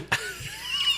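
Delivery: high shrieking laugh; burst of laughter. Real, not acted. Podcast host gets the reference, high shrieking laugh. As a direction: explode into laughter.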